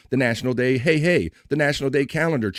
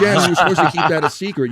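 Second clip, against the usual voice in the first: laughing; 5 semitones higher, 5 dB louder than usual. Real, not acted. Teasing Jen about leaked secret.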